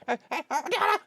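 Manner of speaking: high, choppy mock death cries; hammed-up high ah-ah-ah death yelps; imitated death yells. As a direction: yelp ah ah ah like a dying game character. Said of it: "oh, he yells".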